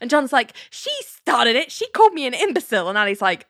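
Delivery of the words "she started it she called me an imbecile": defensive protest. Retold or imitated. Retelling John defending himself.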